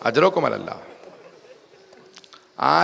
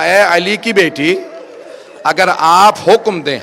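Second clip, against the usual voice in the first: raised, higher call to the lady; loud pledge; 4 semitones higher, 7 dB louder than usual. Retold or imitated. Habib pledges loyalty to Zainab, loud higher call. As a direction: declare loyalty at full voice. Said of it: "آواز دی".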